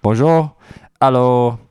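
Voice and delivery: put-on French accent. Host greeting his co-hosts, in a hammed-up French accent. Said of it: "shouldn't have done a French accent".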